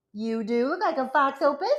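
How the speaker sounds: talking to a beloved pet. Real, not acted. Talking fondly to the dog.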